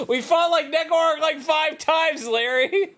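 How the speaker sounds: high, loud, laughing exasperation; exasperated outburst. Real, not acted. Exasperated shout at game.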